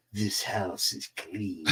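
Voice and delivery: slow, low, soft pronouncement; exorcist imitation. Friend walking into a house as the exorcist, solemnly intoned. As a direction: intone it slowly like a mock exorcist. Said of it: "We call him the exorcist".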